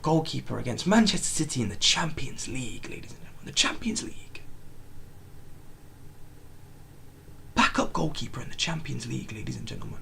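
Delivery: hushed whisper to chat. Real, not acted. Whispering to chat about the backup keeper. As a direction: whisper it in stunned disbelief.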